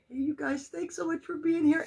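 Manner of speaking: high, loud gushing hello; excited greeting. Real, not acted. Welcoming friends to her first Facebook Live, high and excited. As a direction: burst out welcoming the newcomers.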